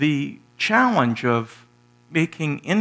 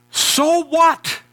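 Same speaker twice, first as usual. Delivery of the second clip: higher and louder, exasperated so what; irritated shout; 7 dB louder than usual. Retold or imitated. Friend finally loses patience with the pastor, loud. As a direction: throw the words back, fed up. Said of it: "he's getting irritated".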